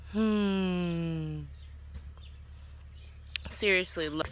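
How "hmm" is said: ordinary delivery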